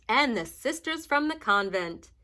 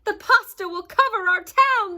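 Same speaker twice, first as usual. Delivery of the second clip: fearful cry; 8 semitones higher, 6 dB louder than usual. A narrator's reading. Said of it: "they cried".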